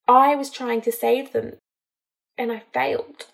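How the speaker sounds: a touch of a break on "I failed"; understated crack in the voice. Acted. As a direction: let the last words crack slightly. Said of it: "her voice cracked at the final words".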